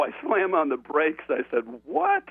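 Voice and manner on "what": very high-pitched incredulous yelp; shocked disbelief. Retold or imitated. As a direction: re-enact stunned disbelief, pitch shooting up. Acts shock at closing time.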